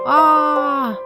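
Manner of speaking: high, loud excited cry. Real, not acted. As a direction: burst out with delight. Raid arrives, excited greeting.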